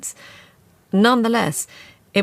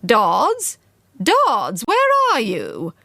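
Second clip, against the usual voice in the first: calling through the house, drawn out; 5 semitones higher, 4 dB louder, much slower than usual. A narrator's reading. Missus Scaife calling for Dodds from the front door. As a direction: call for the maid from the hall. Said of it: "Missus Scaife's voice calling".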